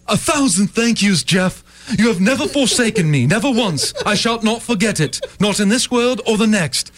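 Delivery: formal knightly speech; old-fashioned courtier. Acted. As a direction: speak like a humble medieval servant. Courtly thanks to coworker.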